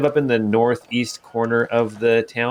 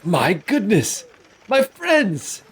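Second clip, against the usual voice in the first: higher, delighted greeting; jovial old man; 10 semitones higher, slower than usual. Acted. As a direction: welcome old friends warmly. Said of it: "you hear another voice".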